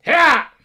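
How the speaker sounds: sharp high yell "Hah!"; Link battle cry. Pretend. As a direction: shout a short heroic grunt. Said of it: "can only grunt and scream".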